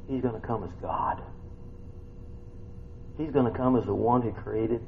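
ordinary delivery

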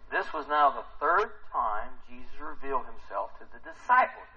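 usual speaking voice

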